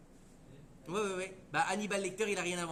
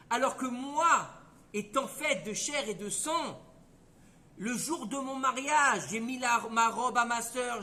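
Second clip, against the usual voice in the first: rising, louder, slower pleading; impassioned plea; 3 semitones higher, 5 dB louder, slower than usual. Acted. Rachel confronts God, building into a louder, slower, impassioned plea. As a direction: press her case with mounting passion.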